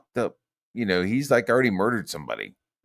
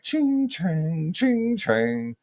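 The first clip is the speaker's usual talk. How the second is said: imitated robot clanking, drawn out and pitched up; slow clanking robot noise; 9 semitones higher, much slower than usual. Imitating the slow robot chasing them, clanking. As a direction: slowly chant the clank of a lumbering machine. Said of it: "super slow and ching-changy".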